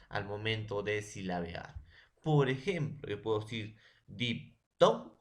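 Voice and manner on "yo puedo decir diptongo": "diptongo" with the middle syllable "ton" punched; stress on TON. Shown as a demonstration. Student demonstrating the stressed syllable to classmates, punching "ton". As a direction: hit "ton" in "diptongo" hardest. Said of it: "el ton es la sílaba con mayor fuerza de voz".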